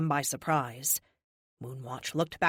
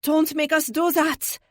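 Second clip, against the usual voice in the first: put-on much higher, slow, louder French-accented cry; desperate French plea; 11 semitones higher, 6 dB louder than usual. A narrator's reading. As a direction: beg him outright in French accent. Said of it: "Magnifique said pleadingly".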